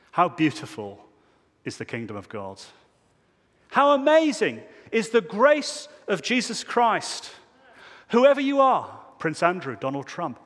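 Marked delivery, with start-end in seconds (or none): 3.7-9.0 much higher and louder, ringing out; exultant preaching. Real, not acted. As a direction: burst out with amazement at grace. Preacher exclaiming grace after cancel culture.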